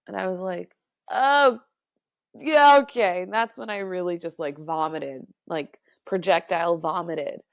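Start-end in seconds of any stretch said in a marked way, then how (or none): 1.1-3.2 loud, high retold "oh, okay"; mock acceptance. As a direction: push it up high and loud in put-on agreement. Retold reaction to his market-rate answer.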